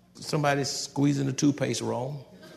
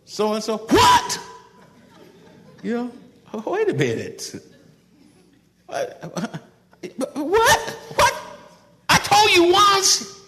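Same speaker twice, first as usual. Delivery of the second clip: much higher, louder mock yell; mock shouting; 5 dB louder than usual. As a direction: ham up a parent yelling at a kid. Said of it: "you shouting and screaming".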